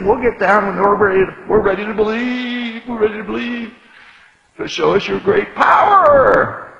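loud, high sneering challenge; mocking. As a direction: taunt him as a scornful priest. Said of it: "also mocked him".

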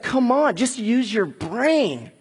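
the enemy king sneering at the people; dismissive taunt. Retold or imitated. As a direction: tell them to give up like it's obvious. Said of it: "he's just like".

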